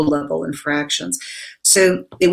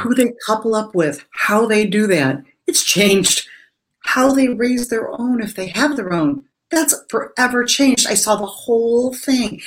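higher-pitched, impassioned build of realization; rising conviction; 6 semitones higher than usual. Real, not acted. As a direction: let the awe lift the voice higher. Overwhelmed realization about woman's changed life.

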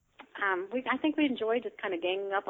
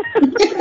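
quick, loud, high laugh; short high laugh; 14 semitones higher, 15 dB louder than usual. Real, not acted. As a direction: laugh at yourself. Laughs at herself.

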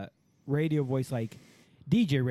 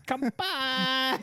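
high silly voice; 11 semitones higher than usual. Pretend. Silly voice during banter.

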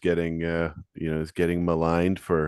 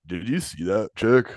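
slow bro voice; 3 semitones higher than usual. Pretend. Imitating guys watching sports.

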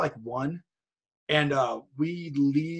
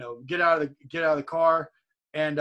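ordinary talk, nothing marked

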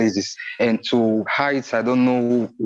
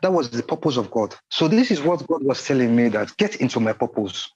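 normal talking voice

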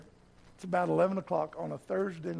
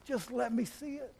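begging prayer; 5 semitones higher, 6 dB quieter than usual. Retold or imitated. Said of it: "I am begging Jesus".

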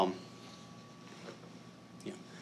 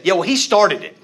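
higher, louder, bratty middle-schooler comeback; childish retort; 9 semitones higher, 13 dB louder than usual. Retold or imitated. Father imitates himself arguing with his son like a schoolboy, much higher and louder. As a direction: snap back like a sulky kid. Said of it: "arguing with, like, a middle school boy".

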